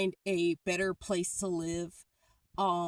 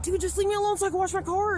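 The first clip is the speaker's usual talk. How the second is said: fast, much higher exasperated plea; exasperated retold plea; 12 semitones higher, faster than usual. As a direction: blurt it out, fed up and pleading. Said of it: "it's like".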